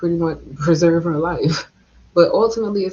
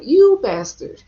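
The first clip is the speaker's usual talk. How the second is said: dramatic anime hero shout; 5 semitones higher, 6 dB louder, slower than usual. Shown as a demonstration. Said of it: "in my anime voice".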